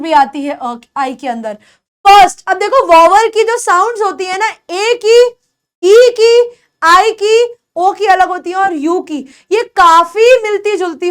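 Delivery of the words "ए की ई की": normal talking voice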